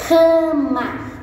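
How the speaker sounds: "Cama" called out high and loud, drawn out; teacher presenting the picture word "Cama"; word sounded out. Shown as a demonstration. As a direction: announce "Cama" slowly and clearly, up high. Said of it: "Aqui, ó".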